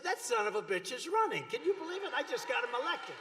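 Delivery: hammed-up indignant disbelief. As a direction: play it as mock outrage. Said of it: "So I said".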